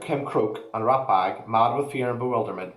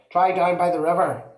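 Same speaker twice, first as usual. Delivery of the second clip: louder, higher call across the yard; 8 semitones higher, 5 dB louder than usual. Acted. Father searching for Wentworth, calling out loud.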